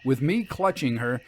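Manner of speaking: usual speaking voice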